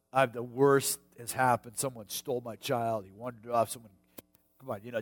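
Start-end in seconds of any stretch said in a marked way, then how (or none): none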